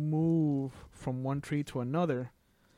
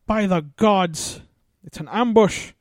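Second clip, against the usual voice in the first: loud, higher-pitched shout, stretched out; yelled alarm; 6 semitones higher, 10 dB louder, slower than usual. Acted. Dwarf struck by an arrow warns of ambush, yelling. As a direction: yell it out to warn the party. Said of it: "you hear him just yell out".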